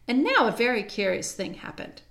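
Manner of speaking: ordinary delivery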